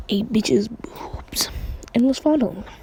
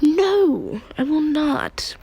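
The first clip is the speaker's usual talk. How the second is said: higher-pitched, mock-stubborn refusal; defiant refusal; 6 semitones higher, much slower than usual. Character refuses the woman telling him to calm down, mock defiant. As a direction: refuse in a raised, put-on huff.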